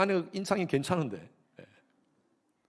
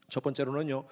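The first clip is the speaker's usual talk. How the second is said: usual speaking voice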